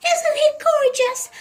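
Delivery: put-on high, gushing old lady; beaming. A narrator's reading. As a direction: beam up with pride at your pet. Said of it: "looking up and beaming at him".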